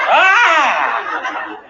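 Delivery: sharp high yelp of pain from a needle jab; loud, high mock ouch. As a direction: yelp as if a needle jabs you.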